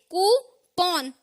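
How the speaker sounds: कूपन slowly as "koo - pawn"; two pieces. Shown as a demonstration. Teacher splitting the word, कूपन as "koo - pawn". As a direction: break कूपन in two with a pause. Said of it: "दो टुकड़ों में बोलना है".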